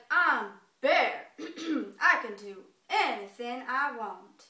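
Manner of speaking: loud, slow bear proclamation; boastful bear. Acted. Bear answering the doubting squirrel, loud slow boast. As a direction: declare it as a proud bear. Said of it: "he's like".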